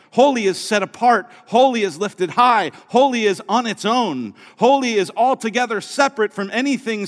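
fervent sermon delivery; preaching. Acted. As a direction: drive it home from the pulpit. Preaching at full force.

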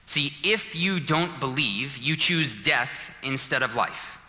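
usual speaking voice

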